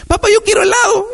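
put-on high, fast childish whine, half crying; whiny child. Preacher imitating a child begging his dad, whiny and high. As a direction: beg like a little kid wanting ice cream.

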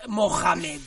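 "Mohamed" with a hard j for the h; Spanish-style Mohamed. Shown as a demonstration. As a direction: say "Mohamed" as Mojamed, rasped in the middle. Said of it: "en España dicen Mohamed".

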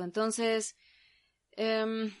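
ordinary talk, nothing marked

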